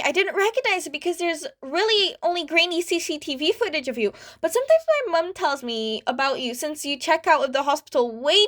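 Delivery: word vomit in delight; excited fanboy. A narrator's reading. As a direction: starstruck kid gushing to his favorite hero. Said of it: "as he word vomits".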